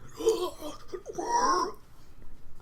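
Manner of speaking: high, strained wheezing gasps; acted creature wheeze, very high-pitched; heaving and wheezing. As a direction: imitate a creature choking for breath. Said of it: "heaving and wheezing in the water".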